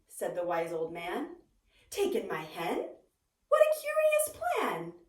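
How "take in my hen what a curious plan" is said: leaps high on What in disbelief; baffled old lady, rising shock; astonished old lady. Acted.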